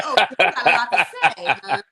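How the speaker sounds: mock sass through laughter; laughing mock. Cohost mocking Reba's sass, laughing. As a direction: laugh while mocking her.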